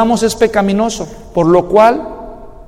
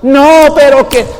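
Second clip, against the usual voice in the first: loud, high protest; mock wife protesting; 7 semitones higher, 6 dB louder, slower than usual. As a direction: burst out objecting, hammed up. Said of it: "van las esposas".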